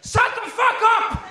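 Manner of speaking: mock furious shout at the Russians. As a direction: bellow it like the big Finn in the cinema. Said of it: "huusi täysin".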